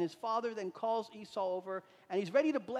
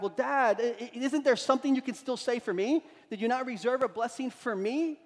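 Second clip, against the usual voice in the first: raised, pleading cry to his father; 5 semitones higher, 6 dB louder than usual. Retold or imitated. Son pleading loudly with his blind father, crying out. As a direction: beg loudly for something left. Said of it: "he cries out to his dad".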